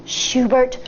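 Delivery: plain reading voice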